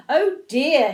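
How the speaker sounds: a worried storybook exclamation; storybook alarm. A narrator's reading. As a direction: exclaim with mild mock fright. Owl alarmed at seeing Gruffalo.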